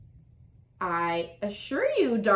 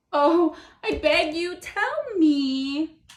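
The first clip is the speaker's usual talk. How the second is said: louder, higher desperate plea; begging turtle; 5 semitones higher, 4 dB louder than usual. Acted. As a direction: beg her to answer. Turtle begs the bagpipe.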